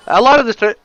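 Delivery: words interrupted by biting tongue; just bit tongue. Real, not acted. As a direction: cut off by a tongue bite. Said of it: "ow, I bit my tongue".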